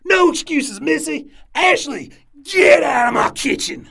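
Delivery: furious shout. Acted. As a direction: throw her out of the kitchen. Chef fires crying cook.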